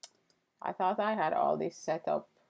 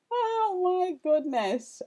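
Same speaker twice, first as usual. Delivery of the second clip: surprised; 13 semitones higher, slower than usual. Real, not acted. Catching herself yelling live.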